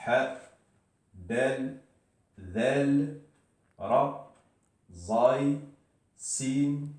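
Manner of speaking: alif, ba, ta, tha, each letter named apart with a small lift in pitch; reciting alphabet. Shown as a demonstration. Teacher recites the Arabic alphabet to learners, letter by letter. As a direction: list the Arabic letters one by one like a drill. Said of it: "Donc, encore une fois".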